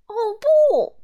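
high, loud cry of alarm; shocked cry. Acted. Miser discovering his carrot nose, loud shocked cry. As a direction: yelp as the nose turns to carrot. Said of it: "他惊呼道".

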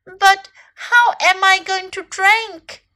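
high, loud, slow question; plaintive cry. A narrator's reading. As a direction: drag out the worried question. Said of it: "cried Little Elephant".

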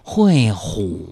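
sloth. Pretend. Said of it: "用树懒的方式来说话".

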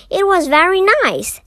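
light, quick bear cub voice; a high, quick young bear. A narrator's reading. Paddington voiced in story.

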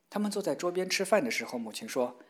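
ordinary delivery

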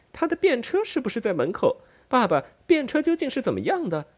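便车 asked high and puzzled, naive; innocent sister. Acted. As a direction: innocent daughter asking father what a carriage is. Amelia asks father innocently.

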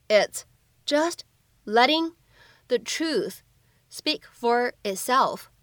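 slow, word groups parted by pauses; slow read-through. Shown as a demonstration. Teacher breaks the film line down for learners, slow and separated. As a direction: read it slowly in small chunks for learners.

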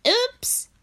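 frog voice. Acted. Frog apologises after sneeze.

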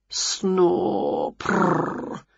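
soft snore into rolled purr; cat snore and purr. A narrator's reading. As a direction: doze off like a purring cat. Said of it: "Wilbur yawned".

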